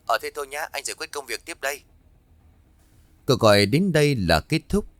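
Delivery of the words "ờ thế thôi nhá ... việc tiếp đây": quiet, higher, quick phone sign-off. Acted. Husband hangs up to return to work, faint phone voice. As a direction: sound distant on the line, wrapping up fast.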